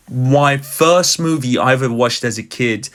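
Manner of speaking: normal talking voice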